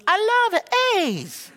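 very high, drawn-out mom voice; slow, squeaky imitation of mom; delighted mom impression. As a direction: gush in an exaggerated high voice. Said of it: "That's how she talks".

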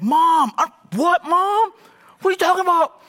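very high, loud mock panic; hammed-up squeaky protest to mom; protesting teenage son. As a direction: yelp it like a caught kid. Acted teen protesting to angry mom.

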